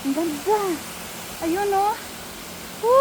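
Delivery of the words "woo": ordinary delivery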